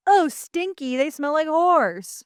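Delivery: high, slow mock disgust; hammed-up grossed-out whine. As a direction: squeal it like a disgusted kid. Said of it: "and they're like".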